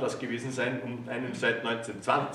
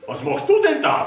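suspicious; 11 dB louder than usual. A narrator's reading. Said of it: "fragte er mich misstrauisch".

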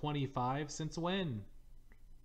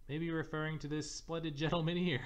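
a touch higher, then a breathy cackle; mocking laugh; 5 semitones higher than usual. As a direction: laugh at your own joke. Character mocks the player, laughs.

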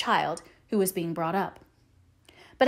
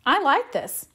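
announcing, pleased; 7 semitones higher, 4 dB louder, slower than usual. A narrator's reading. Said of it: "she announced at length".

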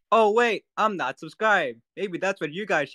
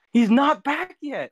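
exasperated cry; 10 semitones higher, 3 dB louder, slower than usual. Real, not acted. Exasperated exclaim at empty stream.

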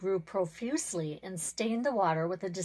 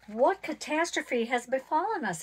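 raised, alarmed cry, a little higher; cried aloud; 5 semitones higher, 4 dB louder than usual. A narrator's reading. Pond spirit waking to find his water gone, crying out in alarm. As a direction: call it out in sudden alarm. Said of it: "he cried aloud".

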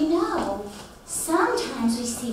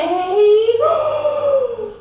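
higher, louder theatrical gasp; mock gasp; 8 semitones higher, 6 dB louder than usual. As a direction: gasp in pretend surprise. Finding a spider.

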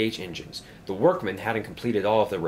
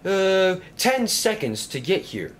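high, raised storybook call; loud announcing of a countdown; shouting; 9 semitones higher, 4 dB louder than usual. A narrator's reading. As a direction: call out to someone far off. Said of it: "he shouted".

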